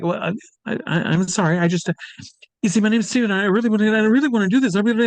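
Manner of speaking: put-on a nervous young fan begging for a chance; flustered pleading. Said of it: "And I was like".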